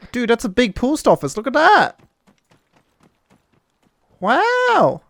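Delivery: very high, rising gushing wonder; over-the-top high-pitched amazement; hammed-up amazement. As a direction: squeal it in mock awe. Marvelling at a big post office.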